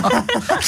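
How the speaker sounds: a sudden squeal of a laugh; high burst of laughter. Real, not acted. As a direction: crack up high and bright. Host laughs at caller's story.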